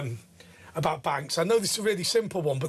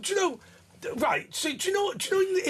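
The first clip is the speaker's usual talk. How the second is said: eager interruption; 10 semitones higher, faster than usual. Real, not acted. Bursting in with a new idea.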